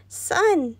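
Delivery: high; urging son; mother calling. A narrator's reading. Mother calls.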